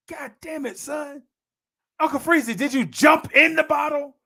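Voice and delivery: high, exasperated mock-dad voice; cussing father. Father catches son reeking of aftershave, high comic exasperation. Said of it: "That's how he would talk to me".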